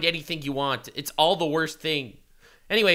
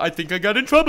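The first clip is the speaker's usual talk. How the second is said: louder mock repeat of the clip; mocking imitation; 4 semitones higher, 5 dB louder, faster than usual. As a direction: parrot his line mockingly. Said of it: "I thought".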